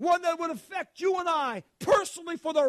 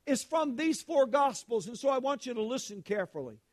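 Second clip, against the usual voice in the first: usual speaking voice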